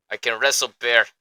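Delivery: lightly put-on Russian accent; mock Russian accent. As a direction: joke that he comes back from Dagestan, mock Russian accent. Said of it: "little bit of Russian accent in there".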